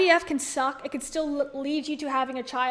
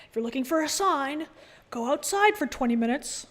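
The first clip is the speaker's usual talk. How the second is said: Dave's catchphrase. Retold or imitated. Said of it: "That's Dave's thing".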